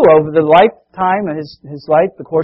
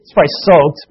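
ordinary talk

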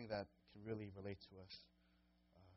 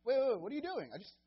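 much higher, louder startled questions; mock disbelief; 11 semitones higher, 10 dB louder than usual. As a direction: react like you can't believe your eyes. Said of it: "And you're like".

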